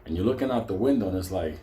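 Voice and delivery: ordinary talk, nothing marked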